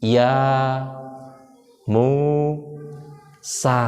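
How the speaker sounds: "Ya... Mu... sa" slowly, syllable by syllable; dieja per suku kata. Shown as a demonstration. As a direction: draw out each syllable of "Ya Musa" with long gaps. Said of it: "ada hurufnya". ("Ya Musa" dilafalkan panjang, terputus per suku kata)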